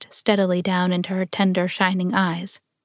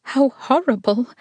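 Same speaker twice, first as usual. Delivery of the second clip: higher, horrified exclamation; terrified cry; 6 semitones higher than usual. A narrator's reading. Woman hearing of murder, crying out in terror. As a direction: recoil in horror as you cry out. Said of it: "Dimple cried in terror".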